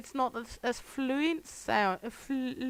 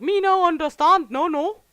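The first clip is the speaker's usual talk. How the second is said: mock broken English; 8 semitones higher, 11 dB louder than usual. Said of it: "He goes like".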